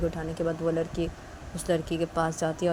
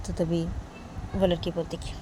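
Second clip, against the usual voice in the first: plain reading voice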